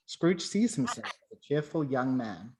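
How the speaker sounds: ordinary talk, nothing marked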